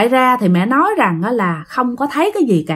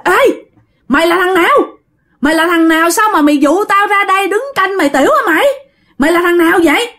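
higher-pitched angry challenge, not truly shouted; acted yelling; 6 semitones higher, faster than usual. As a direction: confront a stranger with indignant, rapid questions. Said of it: "Thì khi mà dì Hai gào lên". (quát hỏi giận dữ, giọng cao, không hét hẳn)

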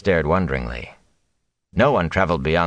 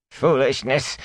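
put-on a grumbling old thatcher, pitched up; 8 semitones higher, slower than usual. A narrator's reading. Old thatcher dismissing the gleeman, mild grumble. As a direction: scoff it out like a grumpy old man. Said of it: "Sen grumbled".

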